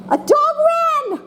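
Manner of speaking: high, slow, thrilled announcement; excited exclamation demo. Shown as a demonstration. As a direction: say it like the best news ever. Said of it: "it could be an excited sentence".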